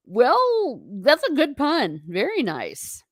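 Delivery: mock impressed. Real, not acted. Reacting to chat pun.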